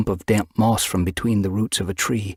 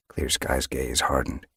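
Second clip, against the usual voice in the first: ordinary delivery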